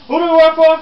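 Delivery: high, loud demand for an answer; rallying shout. Acted. Reporter rousing unenthusiastic New York Times staff, shouting. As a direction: bellow it to rouse the crowd.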